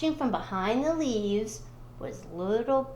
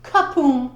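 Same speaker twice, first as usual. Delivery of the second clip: Kapoom effect; 3 semitones higher, 9 dB louder than usual. Acted. Sound of the fall.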